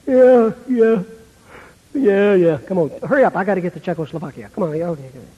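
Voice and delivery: hurried brush-off, tailing down low; barely listen, hustle them along dismissively; distant, distracted. Jesus too busy to give eye focus, distracted. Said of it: "he'd be just kinda distant".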